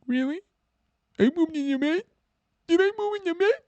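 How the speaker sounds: very high, squeaky little voice; mock girlfriend. Drunk girlfriend learns what she did, squeaky disbelief. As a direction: squeak it in disbelief, slurred and babyish. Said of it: "She's gonna be like".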